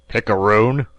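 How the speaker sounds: loud furious shout; enraged cry; angry insult. A narrator's reading. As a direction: spit the insult out. Captain keeps insulting Fogg.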